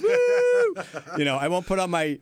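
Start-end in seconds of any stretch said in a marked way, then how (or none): 0.0-0.8 very high, loud drawn-out whoop; hype-man whoo. Shown as a demonstration. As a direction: whoop it up like a club announcer. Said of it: "great strip club announcer voice".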